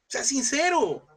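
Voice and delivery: high, stretched-out preaching cry; emphatic exhortation. Real, not acted. Preacher urging the congregation, high emphatic cry. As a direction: urge them, pitched high and very slow.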